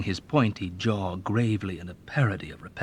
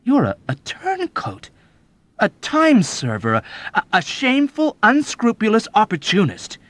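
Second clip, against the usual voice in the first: high, loud outraged disbelief; young man crying out in disbelief; disbelieving cry; 14 semitones higher, 9 dB louder than usual. A narrator's reading. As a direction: cry it out, stammering in shock. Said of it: "Nately cried out in disbelief".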